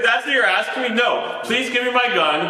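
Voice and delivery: high-pitched, incredulous retold protest; indignant mock pleading. Panicking homeowner demanding his gun from officers, indignant mock plea. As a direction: plead in comic exasperation, pitched well up.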